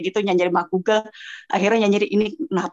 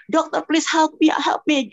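higher-pitched mock pleading; retold desperate plea; 6 semitones higher, slower than usual. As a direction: act out begging the doctor for help. Retold plea to doctor, breathless. (menirukan permohonan panik, nada tinggi)

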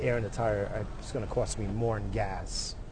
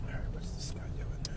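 whispering; 7 dB quieter than usual. Real, not acted. Mutters about stranger nearby.

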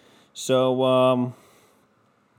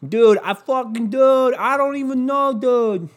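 high, hammed-up New York dude; bad New York accent; 10 semitones higher, 4 dB louder than usual. Podcaster mocking Santa on the screen, bad New York accent. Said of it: "That's a bad New York accent".

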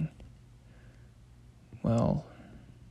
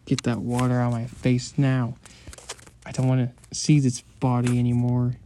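ordinary talk

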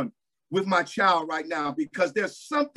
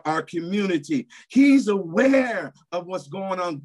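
ordinary delivery

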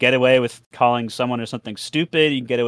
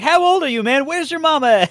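much higher, loud mock yell; imitated trash-talking gamer; 5 dB louder than usual. Player squaring back at a kid, high mock yelling. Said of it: "the other guy's like".

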